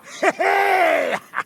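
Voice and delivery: high, loud gleeful laugh; big victorious laugh; triumphant laugh. Acted. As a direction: laugh out in triumph over the silver. Captain reveals the treasure.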